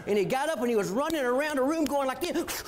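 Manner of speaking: ordinary talk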